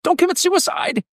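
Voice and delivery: pleading, pitched far up; hammed-up desperate appeal; mock pleading. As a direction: beg Germany in a hammed-up plea. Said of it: "to be like".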